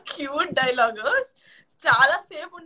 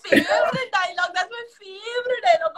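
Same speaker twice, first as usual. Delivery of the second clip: laughing; 6 semitones higher, much slower than usual. Real, not acted. Gushing over favorite line.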